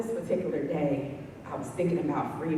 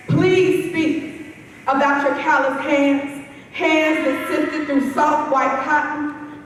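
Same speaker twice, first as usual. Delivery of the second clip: strong, projected poetry recital, raised in pitch; spoken-word poem; 11 semitones higher, 11 dB louder, slower than usual. Acted. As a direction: address it as a plea, in measured phrases with pauses. Performs a poem to a sculpture.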